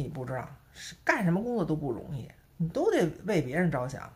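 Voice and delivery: ordinary talk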